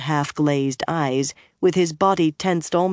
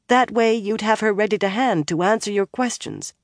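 higher, persuasive appeal; pressing on; 6 semitones higher than usual. A narrator's reading. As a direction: keep pressing your case, pitched up. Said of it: "She pressed on anyway".

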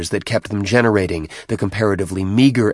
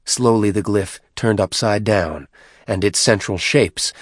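usual speaking voice

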